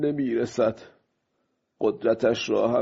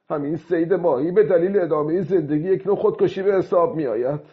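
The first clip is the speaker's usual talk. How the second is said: high, raised voice, quickening toward the end; speaking aloud; 6 semitones higher, 3 dB louder, faster than usual. A narrator's reading. Old man justifying the killing to himself, aloud in a raised voice. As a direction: argue out loud, pitched up. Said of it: "پیرمرد با صدای بلند به حرف خودش ادامه".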